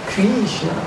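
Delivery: "Krishna" with a long ee; regional mispronunciation. Shown as a demonstration. Teacher showing another region's mistake, "Krishna" with long ee. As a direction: stretch "Krishna" to "Kreeshna". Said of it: "It's not Krishna".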